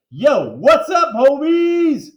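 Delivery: loud high hype shout to the crowd. Acted. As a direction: yell it out to the kids. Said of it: "Mister Hind shouted".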